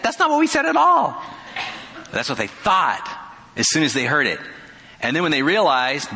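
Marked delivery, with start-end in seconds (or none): 0.0-1.2 very high, loud squeal of disbelief; hammed-up shocked glassmaker; indignant protest. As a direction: squeak out the denial in comic outrage.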